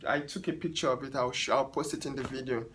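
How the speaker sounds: ordinary talk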